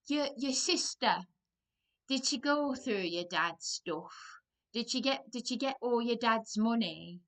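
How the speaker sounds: loud, high mock northern English accent; bad northern accent. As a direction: ham up a northern woman's nosy question. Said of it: "doing a very bad northern accent".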